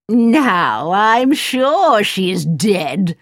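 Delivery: slow, gloating wicked queen; evil queen. Acted. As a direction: drag out the triumph slowly. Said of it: "cried the wicked queen".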